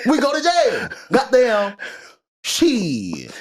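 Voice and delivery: high squeal falling to a drawn-out curse; squeaky put-on rapper voice, easing down; hammed-up high-pitched imitation. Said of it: "I gotta say in his voice".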